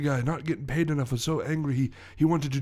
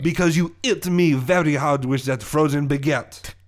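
French villain's indignant accusation; aggrieved Frenchman recounting an injury; French accent. Acted. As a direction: blame them in a thick French accent. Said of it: "my French accent".

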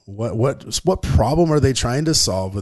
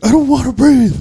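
put-on much higher and louder, a protesting four-year-old; whiny upset kid; 9 dB louder than usual. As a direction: whine it like a sobbing toddler refusing. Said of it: "He was like".

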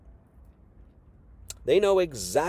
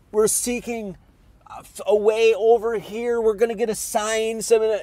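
higher mock voice, a bit odd; mocking Pharisees; 7 semitones higher, 3 dB louder than usual. Preacher mocking Pharisees who want a sign, higher mock voice. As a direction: lampoon the Pharisees begging for a sign. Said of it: "They're like".

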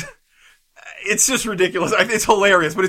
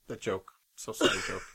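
much softer, lower mock condolence; mock eulogy; 12 semitones lower, 15 dB quieter than usual. Mourning the dead joke in a hushed voice. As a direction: hush it like a graveside farewell.

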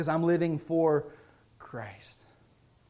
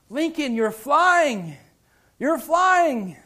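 higher, louder call with a drawn-out "flying"; imitated sister; 8 semitones higher, 7 dB louder, much slower than usual. Sister calling to her airborne brother, imitated child's excitement. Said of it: "she says".

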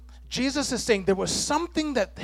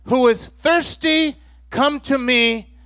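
mildly raised, higher, slow proclaiming voice; quoting in a raised, measured voice; loud proclamation; 6 semitones higher, slower than usual. Retold or imitated. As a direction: call it out slowly to a whole crowd, imitating Jesus. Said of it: "Jesus said in a loud voice".

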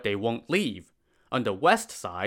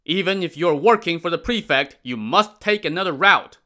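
louder, higher firm command; firm soldiers; 7 semitones higher, 6 dB louder than usual. Acted. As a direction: lay down the rule, loudly. Soldiers order porters away.

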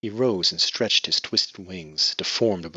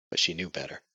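ordinary delivery

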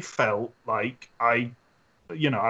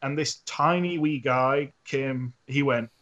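ordinary talk, nothing marked

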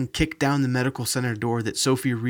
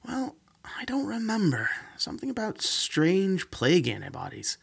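casual aside; 8 semitones higher than usual. Acted. Lucas shrugs, answers casually.

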